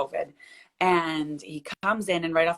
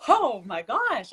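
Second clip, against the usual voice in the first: slow, higher "Oh my gosh"; stunned disbelief; 5 semitones higher, 4 dB louder, much slower than usual. Retold or imitated. After the dad says she talks too much, slow stunned disbelief. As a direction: drag it out in disbelief.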